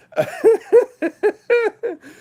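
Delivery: real loud, high-pitched cackle; short fit of squealing laughter; high laughing burst. Real, not acted. Amused by chat comment.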